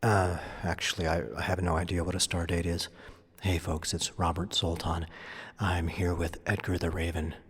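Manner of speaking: soft, awed hushed speech; hushed voices. Acted. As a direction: speak low in reverent awe. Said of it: "We're speaking in hushed voices".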